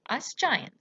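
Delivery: plain reading voice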